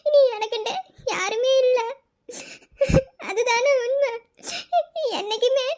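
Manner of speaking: tearful lament broken by sobs; orphan's weeping self-pity; crying alone. Acted. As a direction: grieve aloud, gasping between phrases. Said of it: "ஏன் அழுகுற".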